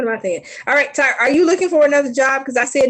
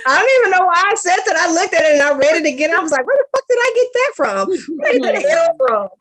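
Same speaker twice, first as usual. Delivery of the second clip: rushed self-mockery; 7 semitones higher, faster than usual. Real, not acted. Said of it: "laughing at own mistake".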